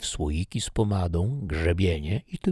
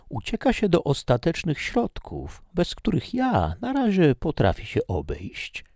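voiced young maid; 11 semitones higher than usual. Maid Sylwia voiced by reader.

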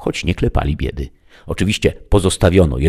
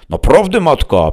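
high, loud protest; exasperated interruption; 12 semitones higher, 8 dB louder than usual. A narrator's reading. Son interrupting his mother's wishes, exasperated. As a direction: cut in loudly, pitched high.

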